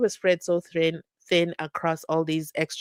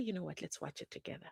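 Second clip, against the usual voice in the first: whispered; 2 semitones lower, 19 dB quieter, faster than usual. Real, not acted. Hushed aside while loading video.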